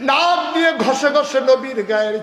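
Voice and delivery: high, loud emotional outcry; full-force preaching. Real, not acted. Preacher telling of the companion weeping, loud impassioned chant. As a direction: cry out the story, easing toward the end.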